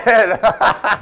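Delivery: bright burst of laughter; laughing. Real, not acted. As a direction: laugh at the reveal. Prank revealed, laughter.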